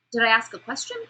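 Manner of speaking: demonstrative upswing on "question"; rising question inflection. Shown as a demonstration. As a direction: voice lifts at the end of "question". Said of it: "my inflection's going to go up".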